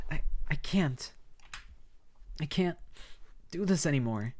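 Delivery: she's at the end of her rope; despairing girl. Acted. Karma voiced, despairing.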